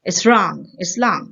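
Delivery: long rhymed with wrong, slow and careful; long like wrong, drawn out; correct long. Shown as a demonstration. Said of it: "उसकी correct pronunciation है लॉन्ग". (long को wrong की तुक में धीरे बोलना)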